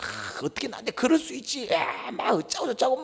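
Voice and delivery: high-pitched mock huffing and grumbling; fuming. Preacher mimics a wronged person ranting to himself, fuming. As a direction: act out someone seething, strained up high. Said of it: "막 씩씩거리고 있잖아요".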